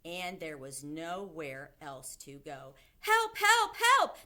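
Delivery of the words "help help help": high, loud panicked cries; screaming for help. A narrator's reading. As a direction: scream for rescue in a comic panic. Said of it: "the man was screaming".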